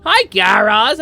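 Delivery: a real, loud, bright hello to Garaz; proper loud hi. Real, not acted. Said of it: "actually say hi to you".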